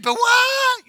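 high, loud startled yelp; mock scream. Fake medium shocked that it worked, mock-screamed. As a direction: break into a comic shriek of shock. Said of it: "She screams".

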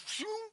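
very high, light "shoo" noise; kid's whoosh. Acted. Kid responding to mom on playground, high whoosh noise. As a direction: imitate a quick head-turn with a soft whoosh. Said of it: "that one kid goes".

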